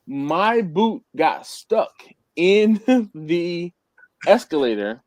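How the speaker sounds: slow, word by word with pauses; drawn out for comic drama; dramatic slow telling. Real, not acted. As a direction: spell out the mishap slowly, a chuckle slipping in. Dramatic retelling of the escalator mishap.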